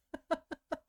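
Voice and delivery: cracking up at your own bad impression; high burst of laughter. Real, not acted. Vlogger laughing at her own skeleton voice, high giggle.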